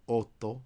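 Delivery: Italian double t. Shown as a demonstration. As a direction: hold the tt in otto. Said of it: "you pronounce them both".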